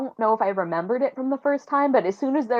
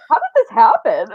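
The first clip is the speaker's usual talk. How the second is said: hammed-up innocent puzzlement, louder and higher; mock bafflement; 6 semitones higher, 9 dB louder than usual. Friend voicing Dorian after the stabbing, mock puzzled. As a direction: play dumb loudly. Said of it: "he's like".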